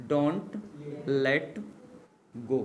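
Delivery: don't, pause, let, pause, go, each word set apart; word by word dictation. Shown as a demonstration. Teacher spelling out don't let go for students, word by word. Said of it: "don't let go said again in one breath".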